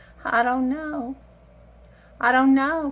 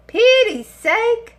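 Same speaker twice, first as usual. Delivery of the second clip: slow, higher and louder, stretched out; drawn-out exasperation; 9 semitones higher, 6 dB louder, much slower than usual. Acted. To viewers about her greying hair, slow exasperated moan. Repeats exclamation, second drawn out.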